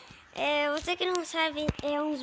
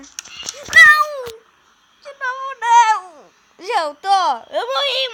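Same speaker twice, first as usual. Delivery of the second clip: loud high panicked cries; panicking gamer; 6 semitones higher, 12 dB louder, slower than usual. Real, not acted. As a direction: yell in panic at the jumpscare. Jumpscared again, dies. (gamer em pânico)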